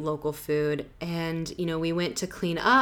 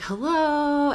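higher, questioning hello; calling out; 9 semitones higher than usual. Retold or imitated. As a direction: call into an empty house to see who's there. Calling into an empty house.

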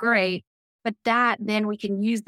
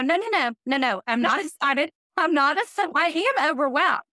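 flustered protest; 6 semitones higher than usual. Retold or imitated. Protesting the pastor's compliment.

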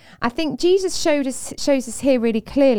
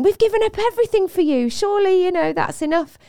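higher-pitched, pleading protest; protesting Peter; 8 semitones higher than usual. Acted. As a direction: plead the case in a raised pitch. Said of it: "Peter's saying".

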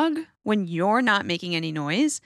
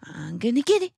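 playful taunt; 5 semitones higher than usual. Shown as a demonstration. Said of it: "I usually say stuff like".

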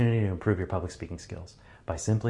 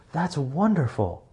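higher, slow, delighted wow; overemphasized happiness; 7 semitones higher, slower than usual. Shown as a demonstration. Speaker demonstrating exaggerated happiness for someone, high and slow. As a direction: beam through the mask with joy. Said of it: "are more than happy".

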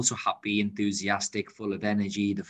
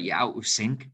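ordinary talk

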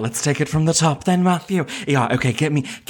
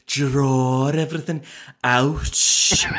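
drawn-out Scouse; 3 semitones higher, slower than usual. Shown as a demonstration. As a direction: stretch the words out in Scouse. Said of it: "a real workout for the jaw".

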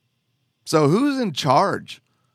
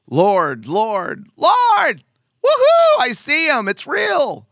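climbing much higher and louder into a hammed-up whoop; mock ecstatic cry; 12 semitones higher, 6 dB louder, slower than usual. Preacher mocking believers greeting Jesus, hammed-up high whoop. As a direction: play the giddy believer shouting for Jesus.

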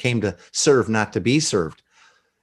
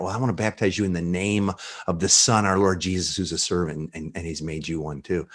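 tender blessing; 6 semitones lower, 7 dB quieter than usual. Retold or imitated. Baptizing in the Son's name.

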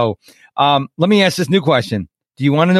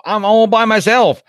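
put-on higher, drawn-out Southern accent; slow Southern wail, pitched up high; 7 semitones higher than usual. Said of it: "why did I go to Southern accent".

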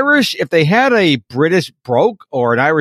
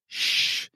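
playful shush; 11 dB quieter than usual. Pretend. Secret, shushing listener.

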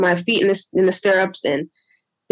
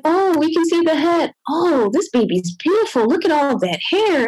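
high, chirpy encouragement; mildly hammed-up nurse cheer; cheery nurse; 7 semitones higher than usual. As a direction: gush over the baby's hair.

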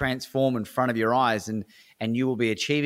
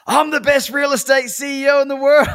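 loud, high yell through a laugh; yelling at the top of lungs; 9 dB louder than usual. Retold or imitated. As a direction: shout it out of your seat, laughing. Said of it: "yelling at the top of his lungs".

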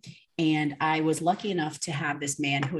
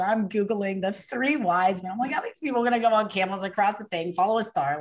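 incredulous, higher and quick; retold disbelief; 4 semitones higher, 3 dB louder than usual. Guest recalls doubting the wise men story, incredulous. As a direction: act out baffled scepticism.

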